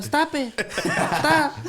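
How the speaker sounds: high-pitched little fish voice; pleading fish. Retold or imitated. Fish pleading with the diner, high mock protest. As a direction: squeal it in mock protest, half laughing. Voicing the fish being eaten.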